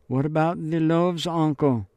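lightly voiced young girl; girl's voice. Marie-Laure asks her uncle.

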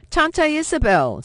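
put-on high, loud child's call across the street; little girl calling her aunt across the street; cried out. A narrator's reading. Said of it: "Sophie cried out".

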